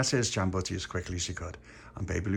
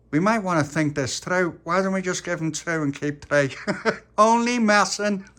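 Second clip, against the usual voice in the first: put-on raised kid voice, joking suggestion; cheeky child schemer; 10 semitones higher, 6 dB louder than usual. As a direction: pitch it up, then crow the joke with laughter. Little Pappy teases about keeping money.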